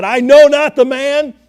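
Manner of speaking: loud, high, heated retort of a man swearing; emphatic cursing denial. Retold or imitated. Peter swearing he never knew the man, loud and emphatic. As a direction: hammer it out like a cornered man denying everything. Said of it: "he cussed and he swore and said".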